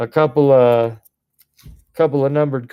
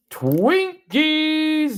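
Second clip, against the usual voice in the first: excited squeal. Real, not acted. Excited outburst after big pull.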